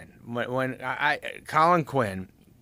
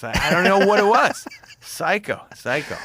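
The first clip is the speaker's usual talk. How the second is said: laughing disbelief; 8 semitones higher, 7 dB louder than usual. Real, not acted. Laughing at own youthful madness.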